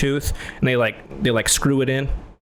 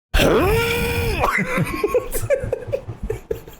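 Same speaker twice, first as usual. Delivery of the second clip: long high wailing shout; loud yelp. Retold or imitated. Reenacting yelling in the dentist chair, a long high cry. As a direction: let out a big high yell. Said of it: "and I go".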